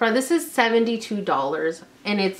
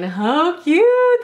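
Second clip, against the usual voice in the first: excited squeal; 8 semitones higher, 5 dB louder than usual. Real, not acted. Gushing over cute socks.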